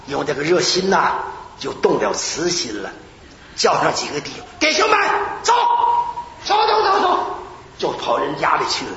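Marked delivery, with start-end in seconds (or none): 4.6-7.4 eager call. Retold or imitated. Rallying brothers to go pray.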